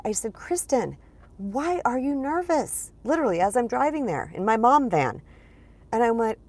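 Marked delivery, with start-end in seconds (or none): 0.3-2.9 higher, drawn-out questioning of herself; probing self-talk. Retold or imitated. Woman asks herself about nerves while driving to a function, probing self-talk. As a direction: gently press yourself with a higher-pitched question.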